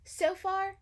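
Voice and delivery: usual speaking voice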